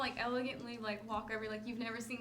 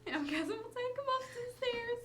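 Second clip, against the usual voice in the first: embarrassed laughing; 12 semitones higher, 7 dB louder than usual. Real, not acted. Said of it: "I don't know how the, any of these things work".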